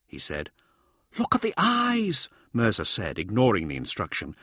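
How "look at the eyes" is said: eager lecturing man, high; lightly voiced eager scholar. A narrator's reading. Narrator voicing Mirza.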